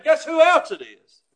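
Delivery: "Guess who else it is?" loud, with a laugh; loud laughing tease. Acted. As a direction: throw it at the crowd.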